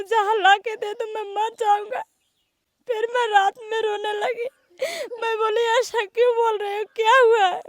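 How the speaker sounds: high tearful voice, sobbing; crying. Real, not acted. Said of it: "महिला है जो रो रही है".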